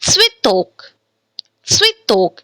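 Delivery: "switok" with a short "i" in the first syllable and stress on "tok"; light "swi", then stressed "tok" in "switok"; reduced YE pronunciation. Shown as a demonstration. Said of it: "Again here ye is unstressed".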